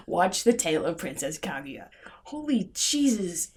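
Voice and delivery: soft unvoiced whisper. Real, not acted. Reviewer warning viewers off the film, whispering.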